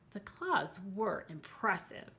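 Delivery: ordinary delivery, nothing marked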